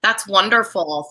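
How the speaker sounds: ordinary delivery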